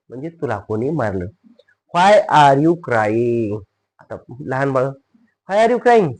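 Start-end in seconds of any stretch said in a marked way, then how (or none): 1.9-3.6 very drawn-out English words; slow model reading. Shown as a demonstration. New sentence read out slowly first. 5.5-6.1 higher-pitched, quicker model question; conversational question. Shown as a demonstration. Same question at speaking pace.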